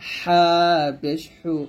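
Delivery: long হা squeezed from mid-throat; tight, pressed h in হা and হু. Shown as a demonstration. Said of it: "গলার মাঝখান থেকে চাপ দিয়ে উচ্চারণ করব". (গলার মাঝখান থেকে চেপে হা পেশ হু)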